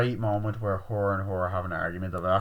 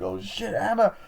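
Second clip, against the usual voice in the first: mock panicked cry; 11 semitones higher than usual. Retelling friends seeing Emma dead, mock panicked cry. Acted reaction to death.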